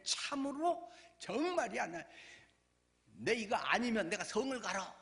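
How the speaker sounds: acted swearing, high and emphatic; emphatic certainty. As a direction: insist on it as if staking your name. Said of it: "확실하게 이야기를 하는 것입니다". (확실하다고 힘주어 장담하는 흉내)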